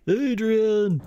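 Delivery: high, loud hammed-up holler; mock yell. Correcting it to Adrian as a joke, hammed-up yell. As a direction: yell the name out, half laughing.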